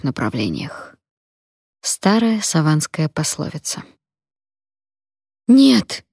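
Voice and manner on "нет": пронзительный крик, выше и громче; отчаянно завизжать; визг. A narrator's reading. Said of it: "завизжала я". (отчаянный визг)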